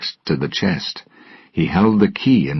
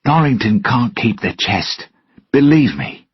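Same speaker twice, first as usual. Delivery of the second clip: pressing, higher and louder plea; urgently; 7 semitones higher, 4 dB louder than usual. Acted. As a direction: urge him to trust you. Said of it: "Jack said urgently".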